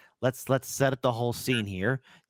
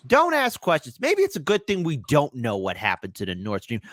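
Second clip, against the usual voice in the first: ordinary delivery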